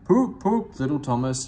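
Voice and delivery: loud high whistle call; loud high poop like a whistle. A narrator's reading. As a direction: mimic an engine whistle. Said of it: "he whistled".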